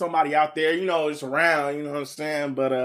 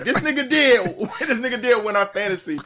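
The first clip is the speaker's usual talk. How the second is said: emphatic shout-out; 7 semitones higher than usual. Shown as a demonstration. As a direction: announce it like a big win. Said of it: "I'm gonna do it right".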